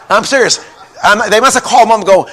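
ordinary talk, nothing marked

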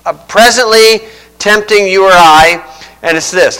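ordinary delivery

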